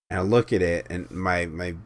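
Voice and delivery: ordinary delivery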